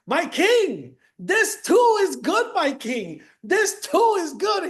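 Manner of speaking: high, slow, emphatic insistence; fervent advisor. Retold or imitated. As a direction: lean hard into every too. Advisor answers king after jail.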